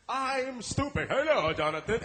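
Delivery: loud, high, hammed-up shout; gleeful full commitment. Improviser embracing the failed bit on stage, loud and hammed-up. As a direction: throw yourself proudly into the silly voice. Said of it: "embrace it".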